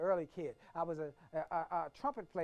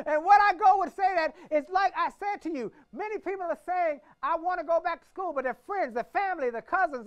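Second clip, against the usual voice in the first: much higher and louder, preaching to the crowd; full-force preaching; 11 semitones higher, 13 dB louder than usual. Acted. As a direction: preach it out big and high.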